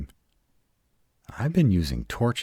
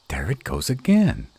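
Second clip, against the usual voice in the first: alarmed; 9 semitones higher, 5 dB louder than usual. Acted. Hears strange noise in cave.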